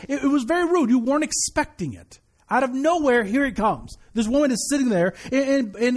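ordinary delivery

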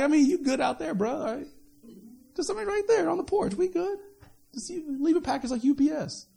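high, breezy casual dismissal; mock brush-off. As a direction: play the lazy bro waving someone off. Christians brushing off Jesus at door.

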